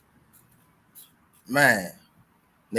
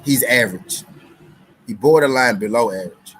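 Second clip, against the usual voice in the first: emphatic verdict; 8 dB louder than usual. Real, not acted. Punchline verdict.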